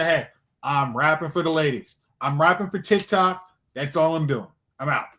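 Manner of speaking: ordinary talk, nothing marked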